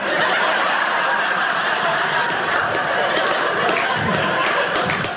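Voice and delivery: long breathy fit of laughter. Real, not acted. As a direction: laugh out hard after the punchline. After wife's punchline.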